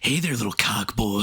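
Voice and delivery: creepy prank-caller voice; Ken Kniff voice. Retold or imitated. Imitating Ken Kniff greeting a victim. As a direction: leer through it like a sleazy caller. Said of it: "sound like Ken Kniff".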